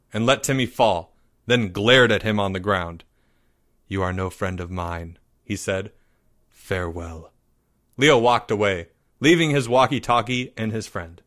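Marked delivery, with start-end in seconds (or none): 3.9-5.2 low, soft and solemn; quietly final; cold rejection. A narrator's reading. Boy disowning his fallen friend, low and cold. Leo coldly ends friendship. 6.5-7.3 low, soft and final; quietly grand goodbye; solemn farewell. A narrator's reading. Boy walking out on his friend, low and solemn.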